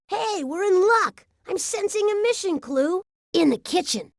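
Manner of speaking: high, eager announcement; excited discovery. Acted. Robot excitedly finds a clue.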